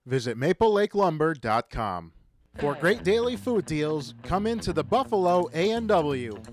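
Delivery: usual speaking voice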